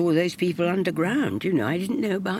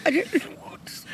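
ordinary delivery

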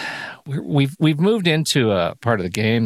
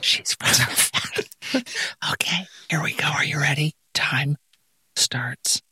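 whisper; 5 dB quieter than usual. Real, not acted. Host whispers before next round.

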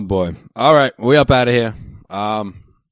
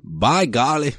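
put-on Indiana accent; 6 semitones higher, much slower than usual. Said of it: "That's what they say over there".

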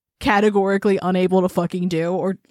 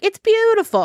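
high, louder gushing voice; mock neighbor gushing; 12 semitones higher, 4 dB louder than usual. Imitating the neighbor who suddenly admires the finished fence, in a high gushing voice. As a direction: gush it like the neighbor suddenly loves it.

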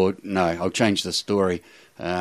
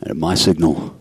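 Russell Crowe. Acted. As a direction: deliver a mock-heroic speech, measured and grave. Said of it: "Russell, oh, he's responded".

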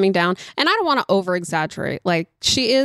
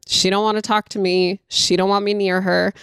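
ordinary talk, nothing marked

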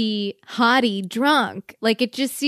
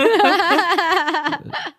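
evil chuckle; 7 semitones higher than usual. Retold or imitated. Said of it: "corny villain laugh".

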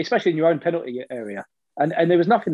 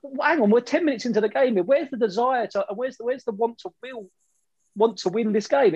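higher, exasperated disbelief; pundit voicing his own disbelief, pitched higher; incredulous thought; 7 semitones higher than usual. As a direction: voice the fan asking where the fight went. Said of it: "you just think".

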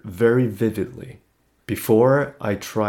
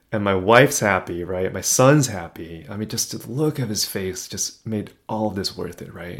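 ordinary talk

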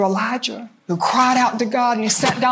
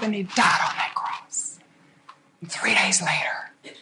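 hushed whisper; 4 semitones lower, 6 dB quieter than usual. Real, not acted. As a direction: breathe the words, barely voiced. Preacher whispers the death scene.

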